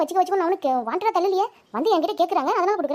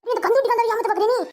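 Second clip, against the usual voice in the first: angry accusation; 6 semitones higher than usual. Acted. Moneylender accuses debtor in quarrel.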